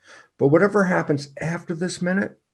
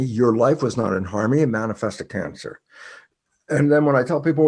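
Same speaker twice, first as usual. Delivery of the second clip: usual speaking voice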